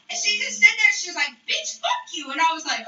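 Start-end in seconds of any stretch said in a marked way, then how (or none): 1.5-2.3 very high mock-angry voice; cussing someone out. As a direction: imitate the rival, pitch shooting up. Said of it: "she's cussing me out".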